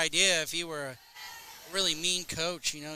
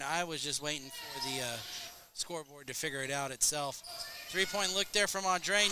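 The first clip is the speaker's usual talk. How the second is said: normal talking voice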